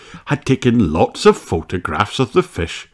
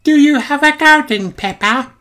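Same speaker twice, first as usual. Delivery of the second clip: very high little mouse voice; Mandy. A narrator's reading. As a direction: ask in a tiny, high-pitched character voice. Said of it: "asks Mandy".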